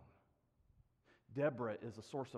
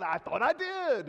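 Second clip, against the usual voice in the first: loud, high mock Scottish protest; Adam; 10 semitones higher, 11 dB louder, slower than usual. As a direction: draw it out as an exasperated imitation Scotsman. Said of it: "And he said".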